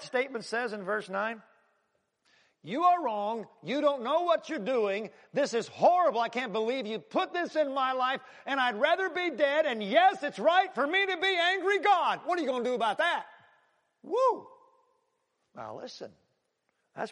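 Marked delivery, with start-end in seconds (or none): 2.6-13.3 higher, louder tirade building to a peak; Jonah's angry rant. Acted. Jonah ranting at God over the plant, furious. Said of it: "he is so angry". 14.0-14.5 high whooping exclamation; excited whoop. Real, not acted. Preacher whooping after Jonah's bold words.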